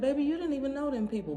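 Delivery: put-on quick loving rebuke to a crying child; Daddy scolding. Voicing her father gently scolding.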